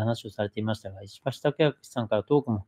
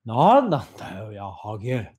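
mellowed, comical version. Retold or imitated. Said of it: "ちょっとまったりさせると".

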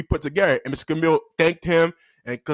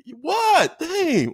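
high, put-out pupil's reply; mock exasperation, pitched up; exasperated pupil; 9 semitones higher, much slower than usual. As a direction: imitate an annoyed kid being called on. Pupil annoyed at being called.

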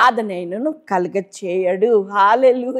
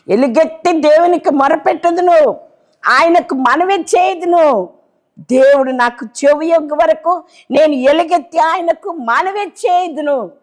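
the same verse cried out higher and louder, slowing at each line's end; loud fervent cry; 7 semitones higher, 4 dB louder than usual. Shown as a demonstration. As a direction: lift the psalm into an impassioned cry to God. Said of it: "కానీ దావీదు భక్తుని". (ఎలుగెత్తి గట్టిగా ఆవేశంగా మొరపెట్టడం)

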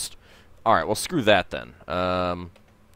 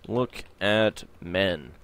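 very slow, spelled-out command voice; typing command; 3 semitones lower, 4 dB quieter, much slower than usual. Acted. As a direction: read the command as you type it. Reads typed game commands aloud.

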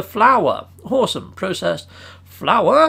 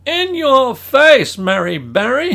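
much higher, louder, slow triumphant taunt; gloating taunt; 6 semitones higher, 7 dB louder, slower than usual. Acted. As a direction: crow it at your rival. Gloating at Mary Berry.

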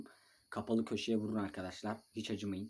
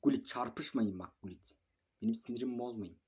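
ordinary talk, nothing marked

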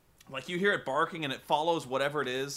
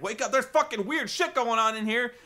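alarmed dog; 7 semitones higher, 4 dB louder than usual. Retold or imitated. Dog voiced, waking family.

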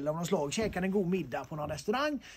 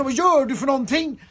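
startled yell; 5 semitones higher, 10 dB louder than usual. Retold or imitated. Startled man shouted at in toilet.

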